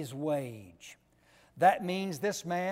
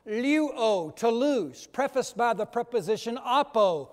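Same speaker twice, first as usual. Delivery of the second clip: ordinary talk, nothing marked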